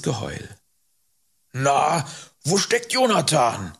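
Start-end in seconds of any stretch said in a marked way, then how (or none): 1.5-3.7 prodding, higher repeated question; insistent. A narrator's reading. Rider asks boy about his companion once more, insistently. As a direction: press for an answer after a leading "Na". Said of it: "fragte Hubert beharrlich".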